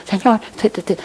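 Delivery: softened, barely voiced murmur; hushed mock prayer; silent prayer. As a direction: pray under your breath, soft and small. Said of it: "estás ahí en silencio".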